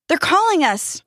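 high, urgent exclamation; excited realisation. A narrator's reading. Jack to Dustin on hearing voices, excited. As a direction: pipe up in sudden excitement.

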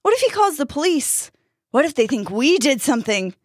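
high-pitched, agitated arguing; panicked protest. A narrator's reading. Jack stopping Dustin from running off, panicked.

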